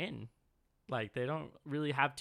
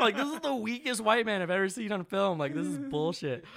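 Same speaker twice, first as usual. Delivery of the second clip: worked-up rant; 7 semitones higher, 6 dB louder than usual. Real, not acted. Ranting about the weak hero.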